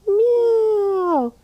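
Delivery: high, drawn-out cat meow. Shown as a demonstration. Teacher meowing like a cat for a child in cat-cow. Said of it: "You do it".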